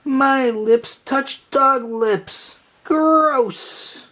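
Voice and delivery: very high, slow squeal of disgust; disgusted Lucy. Acted. Lucy after kissing the beagle, high disgusted squeal. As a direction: spit it out, utterly grossed out.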